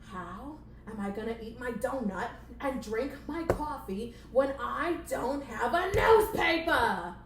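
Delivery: hammed-up boss outrage; exasperated boss. Boss rant building to yell.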